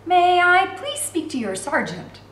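high, slow, sugary politeness masking anger; honey it up, humble and polite through clenched anger; forced humble sweetness. A narrator's reading. Girl sweetly asks the jailer for his sergeant, forced humble honey. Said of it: "I forced honey in my voice in a humble tone".

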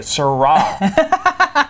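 Syrah slow with a long rolled middle; drawled Syrah. Shown as a demonstration. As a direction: stretch Syrah out, struggling to say it. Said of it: "I can't even say it".

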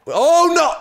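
loud, high cry of dismay; high, loud gamer panic; dismayed shout. Real, not acted. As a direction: yell it as it goes wrong. Gets caught in the game.

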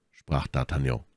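ordinary delivery, nothing marked

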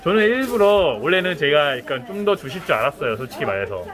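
muffled behind mask; through a face mask. Real, not acted. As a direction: mouth covered by mask.

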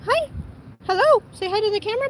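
loud bright dog-talk; excited high greeting. Real, not acted. Greeting puppy for camera.

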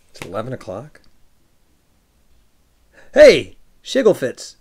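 usual speaking voice

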